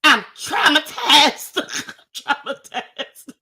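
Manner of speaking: drawn-out, high, breaking comic wail; retold outburst dissolving into gasps; traumatized. Shaken woman answers the man's question, traumatized wail. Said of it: "I gave him the dirtiest look".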